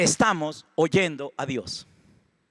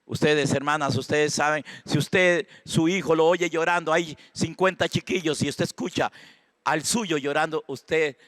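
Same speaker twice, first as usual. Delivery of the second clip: normal talking voice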